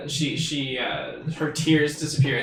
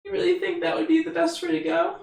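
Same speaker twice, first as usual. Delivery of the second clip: fairy voice; 13 semitones higher than usual. Acted. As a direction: ask sweetly like a tiny fairy. Fairy asks hopefully.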